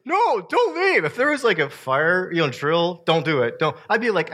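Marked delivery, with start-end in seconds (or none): 0.1-1.0 high pleading whine, half joking. Retold or imitated. Joking pastor begging churchgoers to stay, high pleading voice. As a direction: beg them not to go, mock-desperate. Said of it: "I was like, like".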